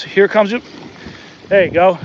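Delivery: ordinary talk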